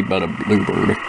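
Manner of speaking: ordinary talk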